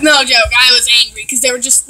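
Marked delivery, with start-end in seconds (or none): none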